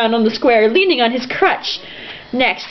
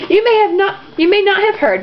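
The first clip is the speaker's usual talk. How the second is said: newsboy voice; 10 semitones higher than usual. Acted. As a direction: shout it like a newsboy. Reading a quote in character.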